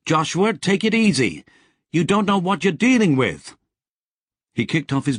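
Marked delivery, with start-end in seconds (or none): 0.1-3.6 higher, louder call of warning. Acted. Sally calling a warning to Joshua as he walks off, louder. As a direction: call out to him across the beach. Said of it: "Sally called".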